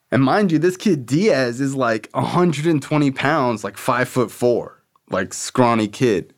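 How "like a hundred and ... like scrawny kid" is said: ordinary delivery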